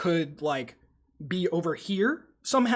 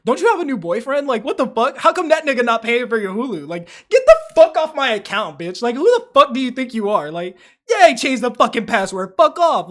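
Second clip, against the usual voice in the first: loud, higher yelling at the ex; mock angry rant; 6 semitones higher, 9 dB louder than usual. Streamer yelling at ex over Hulu, loud angry rant. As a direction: tell her off, full volume.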